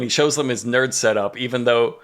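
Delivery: normal talking voice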